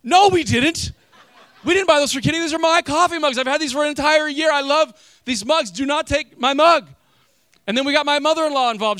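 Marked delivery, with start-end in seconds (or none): 0.0-6.9 loud, high, mock-outraged insistence; indignant protest. Pastor retelling the fight with his brother-in-law, mock outrage. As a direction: defend your mugs like a child. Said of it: "she goes".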